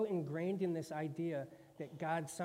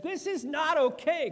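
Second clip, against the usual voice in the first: much higher and louder outburst; Job losing it; 13 semitones higher, 12 dB louder than usual. Acted. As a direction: let Job explode at God. Job finally loses it.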